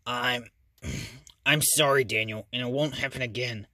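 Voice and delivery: grudging apology through gritted teeth; through clenched teeth. Acted. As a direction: force it out between teeth. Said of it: "through clenched teeth".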